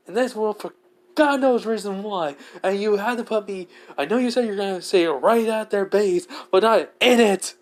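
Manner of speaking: high-pitched, agitated ranting; worked-up, complaining outburst; exasperated rant. Acted. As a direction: rant at the one who dumped you here. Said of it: "As I'm literally ranting".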